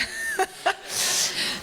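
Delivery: breathy embarrassed giggle; nervous laugh. Real, not acted. Telling the church she hoped he was gone, nervous laugh.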